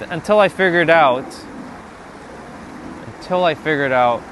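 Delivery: normal talking voice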